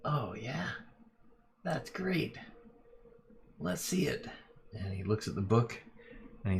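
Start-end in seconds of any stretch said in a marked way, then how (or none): 0.0-4.3 higher, pleased crime boss; a shady boss eager to see the loot. Acted. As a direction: boss greeting his henchman, much higher voice. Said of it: "He goes".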